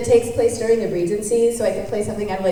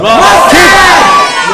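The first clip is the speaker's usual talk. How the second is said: excited shout; 9 semitones higher, 13 dB louder than usual. Real, not acted. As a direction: shout the answer out, high and loud. All shout favorite character together.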